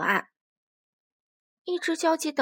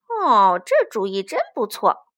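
low, loud character voice; loud, lower-pitched badger delight; badger exclaiming; 4 semitones lower, 7 dB louder than usual. A narrator's reading. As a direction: exclaim approval as a hearty badger. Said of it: "獾先生叫道".

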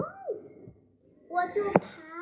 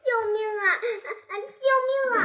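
mock cry for help; 6 semitones higher, 4 dB louder, much faster than usual. Daniu stuck, calling for help.

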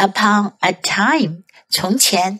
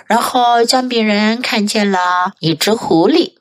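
usual speaking voice

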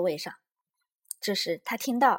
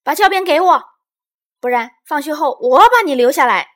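high, very loud demand; angry yelling; 7 semitones higher, 15 dB louder than usual. A narrator's reading. Little Bear turns the threat on Lizi, yelling angrily. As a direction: yell the threat back. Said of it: "她大喊着".

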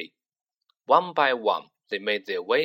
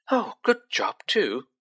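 higher, brisk mother's voice; brisk, higher motherly tone; 7 semitones higher, slower than usual. Acted. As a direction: clip it off briskly like a busy mum. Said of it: "said Missus Weasley briskly".

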